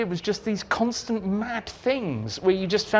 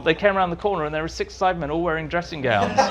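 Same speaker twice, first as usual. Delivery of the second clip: usual speaking voice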